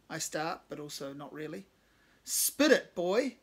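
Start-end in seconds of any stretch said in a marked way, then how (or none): 2.2-3.4 much higher, louder mock southern drawl; southern accent. Cave pressing stammering Glenn, put-on southern accent. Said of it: "affecting some weird southern vibe and accent".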